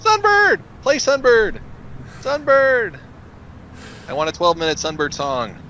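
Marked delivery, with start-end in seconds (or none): 0.0-3.0 high, loud chanting request; concert crowd yell. Pretend. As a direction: yell for the encore. Concert heckle shout for song.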